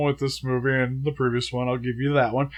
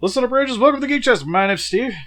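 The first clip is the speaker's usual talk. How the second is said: high, bright host greeting; show host intro; 9 semitones higher than usual. Acted.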